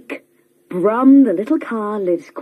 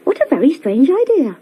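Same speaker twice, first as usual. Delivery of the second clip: quicker, higher puzzled little car; Brum's wonder; 6 semitones higher, faster than usual. A narrator's reading. Brum puzzling over catching lunch, quick and higher. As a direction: muse aloud in quick surprise. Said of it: "thought Brum".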